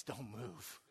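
tense whisper. Retold or imitated. Jeff whispers to his friend, hushed and tense. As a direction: freeze him with a barely voiced command.

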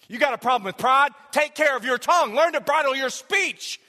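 high, loud, driving sermon delivery; full-force preaching. Real, not acted. Preacher telling the church to bridle the tongue, impassioned. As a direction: drive the point home to the congregation.

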